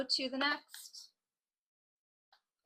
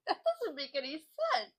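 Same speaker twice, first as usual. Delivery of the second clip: laughing; 8 semitones higher than usual. Real, not acted. Laughs at own joke.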